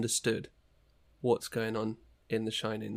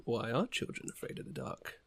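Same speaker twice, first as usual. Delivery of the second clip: hushed, eerie whisper of the question; spooky whisper; 10 dB quieter than usual. Pretend. Cohost repeats the question to spook Alan, in a whisper. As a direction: whisper it like a ghost story.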